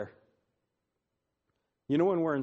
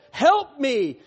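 high, loud drawn-out cry for help; preacher crying out a prayer at full voice; 10 semitones higher, 9 dB louder than usual. Retold or imitated. David crying to the Lord, loud impassioned cry. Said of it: "He's crying to the Lord".

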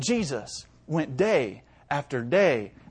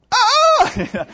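high, loud shocked yelp with a laugh; mock shriek of horror; 10 dB louder than usual. Parent sees sinful paper, mock horrified yelp.

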